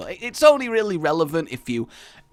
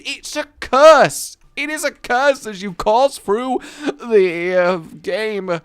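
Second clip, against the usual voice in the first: slow, loud and higher mock-doom delivery; mock dramatic; 7 semitones higher, 8 dB louder, slower than usual. Gamer joking about rerolls, in mock doom. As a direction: proclaim the curse like a doom-laden pun.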